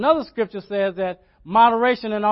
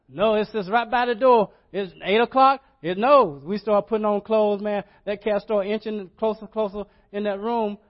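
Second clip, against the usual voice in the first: ordinary talk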